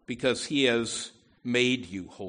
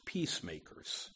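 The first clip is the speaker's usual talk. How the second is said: whispered; 8 semitones lower, 10 dB quieter than usual. Real, not acted. Closing a point, dropping the voice.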